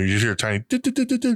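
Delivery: high imitated hammer taps; tapping noise. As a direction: mimic tiny hammers tapping.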